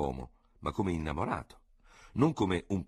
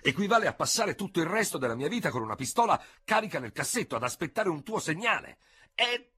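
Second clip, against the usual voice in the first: stunned outraged general; 13 semitones higher than usual. A narrator's reading. Ferraud aghast at his sentence, high outraged protest. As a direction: cry out in disbelief at the absurdity. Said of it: "con accenti di profonda e sbigottita convinzione".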